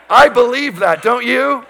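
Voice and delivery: preacher raising his voice for a response. Shown as a demonstration. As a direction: call it out to wake the congregation. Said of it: "Two or three of you do".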